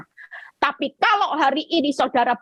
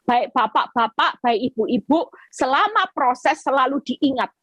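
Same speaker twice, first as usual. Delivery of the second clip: ordinary talk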